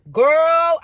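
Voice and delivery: put-on loud, high, stretched "girl"; emphatic cautioning call; drawn-out warning. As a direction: call it out long to warn her. Voicing women of the past warning.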